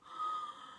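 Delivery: sharp surprised gasp; high gasp. Acted. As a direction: gasp in amazement. Amazed gasp.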